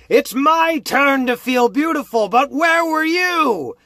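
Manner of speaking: high, loud crazed ranting; unhinged shouting. Acted. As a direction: rant at the aunt through the door. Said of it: "Lindsay shouts out".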